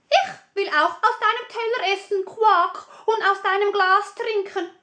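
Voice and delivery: the frog making his demands. Acted. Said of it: "Der Frosch sagte". (hohe, schnelle Froschstimme)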